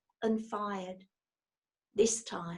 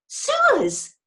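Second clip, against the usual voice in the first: much higher, sudden cry; sudden blurt; 8 dB louder than usual. A narrator's reading. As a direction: blurt it out all at once. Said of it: "said Judy suddenly".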